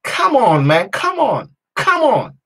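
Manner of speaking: high, forceful urging; insistent, fired-up calls; exhorting. Real, not acted. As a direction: rouse them, voice jumping up. Exhorting listeners to wake up.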